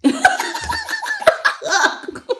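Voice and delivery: long squealing fit of laughter; long high laugh. Real, not acted.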